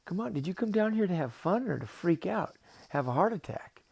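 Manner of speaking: high, emphatic creature voice; lively cartoon octopus, swooping high; exasperated octopus. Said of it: "And the octopus said".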